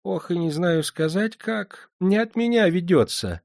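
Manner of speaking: put-on high, sugary old crone voice; syrupy, ingratiating old woman; unctuous old woman. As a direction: fawn over him like a sly old moneylender. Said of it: "елейно отозвалась она".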